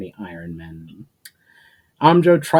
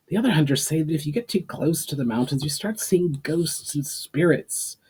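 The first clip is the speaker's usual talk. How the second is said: irritated, higher voice; testy; 6 semitones higher than usual. Acted. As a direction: snap it out testily. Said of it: "I responded testily".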